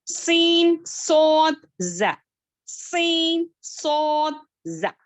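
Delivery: letters called out loud and high for repeating; loud letter drill. Shown as a demonstration. Teacher calls out letters for students to repeat, loud. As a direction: drill the letters loudly for the class to echo. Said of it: "पढ़े मेरे साथ".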